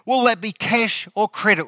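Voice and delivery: put-on bright, high smiling shop-girl voice; smiling cashier. Checkout girl greets the customer, high and smiling. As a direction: beam at him as the cheerful checkout girl. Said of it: "with a big smile over her face".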